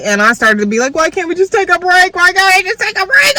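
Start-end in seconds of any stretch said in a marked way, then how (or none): 0.9-2.1 high, pleading cry breaking into a wail; crying plea. Retold or imitated. To boyfriend, begging for a break, crying. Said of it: "And I started to cry". 2.1-3.3 shouted plea. Retold or imitated.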